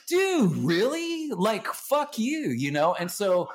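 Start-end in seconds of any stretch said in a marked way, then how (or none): 0.1-2.5 high, slow, drawn-out disbelief; sarcastic incredulous band chorus; mock outrage. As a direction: stretch it out like you can't believe the question. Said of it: "And so we all like".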